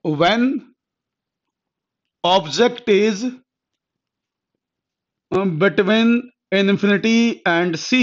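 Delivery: drawn out word by word; teacher dictating a heading to students, very slowly; very slow dictation. Shown as a demonstration. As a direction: dictate slowly so they can copy it.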